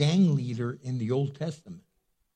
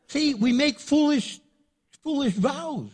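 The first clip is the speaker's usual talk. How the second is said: much higher, louder preaching climax; preaching emphasis; 12 semitones higher, 4 dB louder than usual. Real, not acted. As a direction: hammer the lesson home. Preacher driving the point home.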